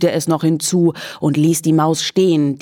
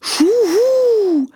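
owl hoot. A narrator's reading. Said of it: "machte der Uhu wieder".

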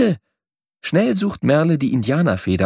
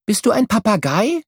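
put-on a lightly higher, curious girl's call; Merle calling out; 10 semitones higher than usual. A narrator's reading. Girl Merle calls up to the bird, curious. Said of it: "ruft Merle ihm zu".

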